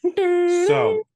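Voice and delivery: big high shout; loud shout. Real, not acted. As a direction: belt it out. Sudden shout.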